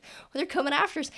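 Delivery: quick, high-pitched urgent voice; archer's warning. Acted. Archer warning others they're being chased, urgent. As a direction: rattle it off high and fast. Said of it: "the one that shot the arrow said".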